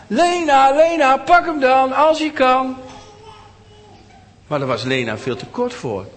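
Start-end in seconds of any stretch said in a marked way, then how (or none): 0.1-2.8 high, loud taunting singsong; teasing children. Acted. Children teasing small Lena about her doll, high taunting voice. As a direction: taunt her like a mean kid. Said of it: "En dan zeiden ze tegen Lena".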